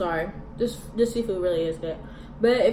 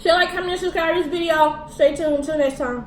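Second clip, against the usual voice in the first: much higher and louder, pumped up; hyped outro; 7 semitones higher, 7 dB louder than usual. Real, not acted. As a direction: sell the subscribe call.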